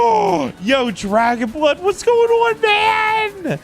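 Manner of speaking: very high, whooping hello; excited shouted greeting. Real, not acted. Streamer greeting a viewer who turns up, thrilled. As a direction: squeal the greeting, stretching the last words.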